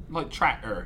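rustic Norfolk farmer accent on "tractor". Shown as a demonstration. Guest attempting Norfolk for the podcast host, country accent. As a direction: try a Norfolk country burr. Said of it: "do your best Norfolk accents".

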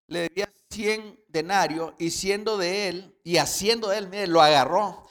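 loud, emphatic sermon reading; fervent preacher voice; forceful preaching. Acted. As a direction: preach the parable out to the congregation. Preacher narrating parable loudly.

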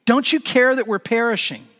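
disciples screaming at him, high and loud; panicked cry at the sleeping Jesus; mock scream. A narrator's reading. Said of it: "screaming at him".

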